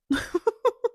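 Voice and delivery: high, loud laugh; burst of laughter. Real, not acted. Laughing at her own answer about salary to peers. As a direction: crack up at the punchline.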